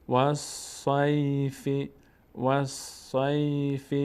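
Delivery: slow "والصیف" ending in an audible "fi"; continuing form, voweled ending. Shown as a demonstration. Teacher reciting syllables for learners, slow with final kasra. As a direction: join wa to a held s, finish "والصیف" on fi. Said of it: "کسره و فتحه و ضمه".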